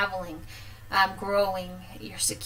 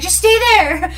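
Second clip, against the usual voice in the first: much higher, loud yell across the street; shouted order; 10 semitones higher, 12 dB louder than usual. Retold or imitated. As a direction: mom shouting to her child to stay put. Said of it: "She's like".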